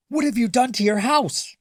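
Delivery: high, loud indignant cry; indignant shout. A narrator's reading. Neighbors shouting at the man, high and loud. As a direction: shout it up at him, outraged. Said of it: "they shouted at the man".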